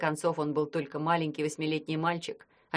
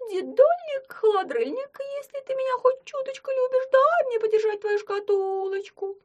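much higher, louder coaxing squeak; squeaky wheedling; 7 dB louder than usual. Acted. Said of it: "попросил оборотень".

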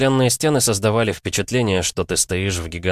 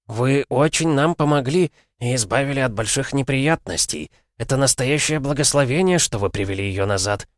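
put-on grateful old man; 6 semitones higher than usual. A narrator's reading.

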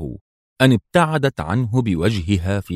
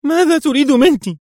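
high, loud, frantic demand from a scared woman; shrill-edged nervous shout; nervous outcry; 7 dB louder than usual. A narrator's reading. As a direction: cry out at him on edge. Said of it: "هتفت في عصبية".

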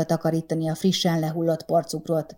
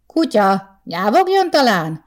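higher, louder sarcastic jab; sharp retort; 4 semitones higher, 5 dB louder, slower than usual. A narrator's reading. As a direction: snap back mockingly. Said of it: "szúrt vissza Márton".

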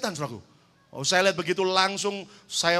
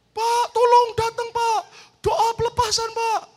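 high-pitched pleading phone voice; panicked caller; 7 dB louder than usual. Retold or imitated. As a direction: beg the pastor to come, voice up high. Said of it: "Saya pernah tiba-tiba ditelepon". (penelepon panik memohon dengan suara tinggi)

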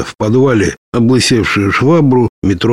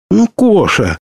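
put-on girl's voice; 10 semitones higher than usual. Lera answers the narrator.

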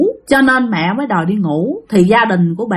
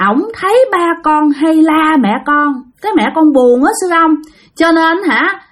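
put-on high, begging child's voice; pleading little child; 5 semitones higher than usual. Child spirit begging the monk to save her mother, high pleading voice. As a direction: whine and beg like a small kid. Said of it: "mới năn nỉ sư ông".

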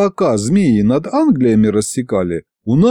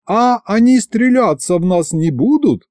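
high, fearful dragoness; worried, high-pitched plea; timid drawl; 11 semitones higher than usual. A narrator's reading. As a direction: draw the question out timidly. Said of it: "боязливо протянула Горыня".

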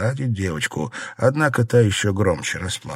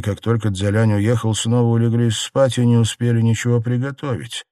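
plain reading voice

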